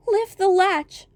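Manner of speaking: high, slow, frail call; a sick old woman calling from bed; weak old grandmother. Acted. Grandmother calls out.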